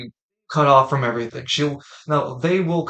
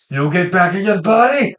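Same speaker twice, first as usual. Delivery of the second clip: mock ghost shout; 5 semitones higher, 8 dB louder than usual.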